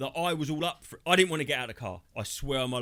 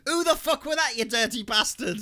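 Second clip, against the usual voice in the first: a much higher, louder imitated woman scolding; mock outraged woman; 7 semitones higher, 5 dB louder than usual. The woman at the dogging site scolding the farter, a high mock outrage. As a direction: play the offended woman telling off the farter. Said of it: "and she went".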